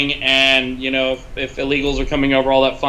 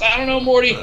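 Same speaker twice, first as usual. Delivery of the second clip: raised, weary cartoon grumble; Rick impression; 7 semitones higher, faster than usual. Answering Morty in a cartoon voice, weary and raised. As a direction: toss it off like a tired cartoon grandpa. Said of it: "that Rick and Morty episode".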